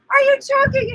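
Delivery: high, loud retold panic; loud worried cry; alarmed question. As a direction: call out in alarm as you burst in. Friend rushes in, alarmed.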